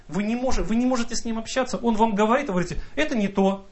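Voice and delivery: ordinary delivery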